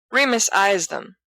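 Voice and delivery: ordinary delivery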